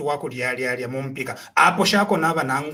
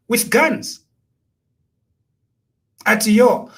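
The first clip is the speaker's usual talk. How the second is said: ordinary talk, nothing marked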